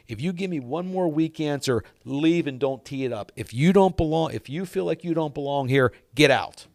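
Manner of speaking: stern retold ultimatum, punched at the end; super serious. Mentor shaking a doubting golfer, super serious. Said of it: "super serious face".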